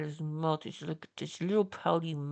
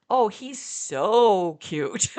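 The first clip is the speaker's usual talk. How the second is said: gushing agreement; 4 semitones higher, 12 dB louder, much slower than usual. Retold or imitated. She gushes back at fan.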